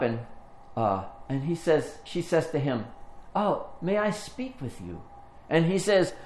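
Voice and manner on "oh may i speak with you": high, soft, polite request; gentle request. Retold or imitated. Older woman asks a fighting young man to talk, gently. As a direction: step in softly, courteously. Angelou calms an angry rapper.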